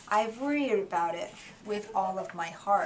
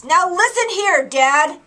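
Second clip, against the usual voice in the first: very loud, high angry yell; 7 semitones higher, 12 dB louder than usual. Acted. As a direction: yell right in his face. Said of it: "I yelled at the Lorax".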